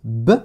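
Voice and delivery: B sec, le son seul; son de la lettre ba. Shown as a demonstration. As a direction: prononce juste le son « b », isolé après une pause. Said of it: "qui correspondait au son". (son « b » isolé, comme en leçon)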